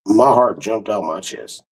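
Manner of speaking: ordinary delivery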